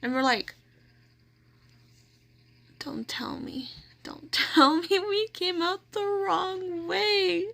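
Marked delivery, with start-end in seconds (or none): 2.8-3.7 whispered in dawning dread. Retold or imitated. Sisters realise the wrong lot, whispering in dread. 4.0-7.5 high, slow, laughing through the words; half-laugh, half-wail it; laughing disbelief. Retold or imitated. Told they parked elsewhere, high laughing disbelief. Realising wrong parking lot.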